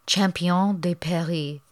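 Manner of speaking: slow, mock French Champion de Paris; French accent. Shown as a demonstration. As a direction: try the French name, a bit hammy. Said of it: "ready for my French again".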